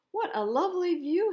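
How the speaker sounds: high, loud delighted cry; exclaimed. A narrator's reading. Thomas marvelling at the mountain view, delighted. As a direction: gasp out the wonder of the view. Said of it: "he exclaimed".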